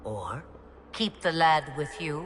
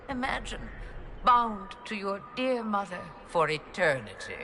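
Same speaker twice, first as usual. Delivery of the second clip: high, gleeful taunting; theatrical mockery; mocking taunt; 5 semitones higher than usual. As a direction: relish the taunt, pitched high. Mocking her daughter.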